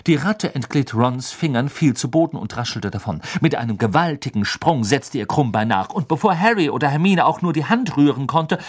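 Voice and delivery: ordinary delivery, nothing marked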